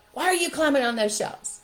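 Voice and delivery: high, loud indignant question; teacher's scolding. Retold or imitated. Teacher confronting children climbing on shelves, scolding. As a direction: demand it like a cross teacher. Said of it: "what I used to hear teachers doing".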